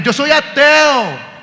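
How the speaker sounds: high, stretched-out bragging; boastful atheist. Retold or imitated. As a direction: show off, drawing out each word.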